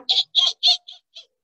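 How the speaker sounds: very high, piercing little eeks; bat squeaks. Retold or imitated. Bats make noise in the house, high squeaks. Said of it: "the bats come flying out".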